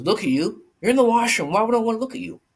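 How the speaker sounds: high, loud imitation of an exasperated girlfriend; retold indignant yelling. Girlfriend woken and called to the washroom, imitated indignant yelling. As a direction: throw it back indignantly, voice way up. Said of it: "She starts to yell and scream".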